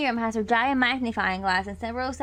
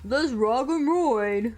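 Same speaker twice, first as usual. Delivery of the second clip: battle cry; 4 dB louder, much slower than usual. Acted.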